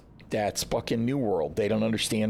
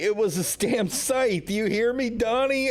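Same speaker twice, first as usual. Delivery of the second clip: pitched way up, insisting on it; high-pitched rant; 10 semitones higher than usual. Real, not acted. Ranting high-pitched to Donnie about the scythe. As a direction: hammer home how good it was.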